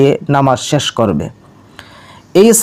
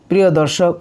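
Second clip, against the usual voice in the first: ordinary talk, nothing marked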